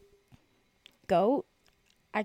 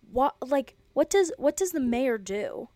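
louder, baffled what does the mayor do; loud mock bafflement; incredulous outburst; 4 semitones higher, 9 dB louder than usual. Real, not acted. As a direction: toss the question out in disbelief. Baffled by Chicago mayor's job.

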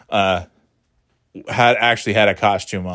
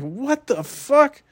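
high, slow, put-on indignant cry; hammed-up shocked swear; mock outrage; much slower than usual. Mock outrage at kid's remark.

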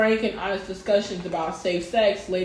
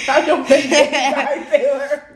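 laughing; 7 semitones higher, 7 dB louder than usual. Real, not acted. Laughing at friend's story.

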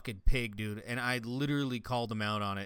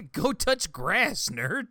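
mocking; 7 semitones higher, 4 dB louder, much slower than usual. Mocking mobile gamers.

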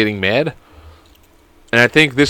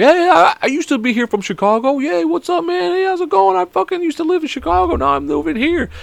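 much higher, hammed-up eager greeting; excited greeting. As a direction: mimic an over-excited chat with a stranger. Acting out an eager greeting to a fellow Chicagoan.

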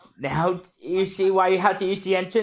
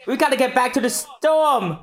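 normal talking voice

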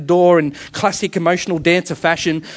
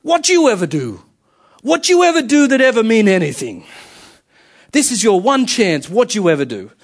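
high, confronting New York director; mock yell in New York accent; New York accent shout; 6 semitones higher, 3 dB louder than usual. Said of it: "shouts him in the face".

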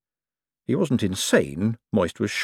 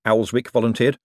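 usual speaking voice

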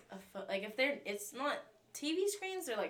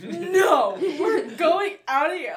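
loud, high, drawn-out insistence; meltdown yell; 8 semitones higher, 13 dB louder, much slower than usual. Retold or imitated. Child dragging mom away from clowns, panicked shout. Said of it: "she's having a full meltdown".

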